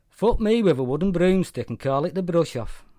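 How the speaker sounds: put-on loud, high drunken Welsh slur; a Welsh drunk hailing an old mate loudly; boozy Welsh bellow, words slurring together; drunken slurred Welsh. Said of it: "he says in a drunken, slurred Welsh voice".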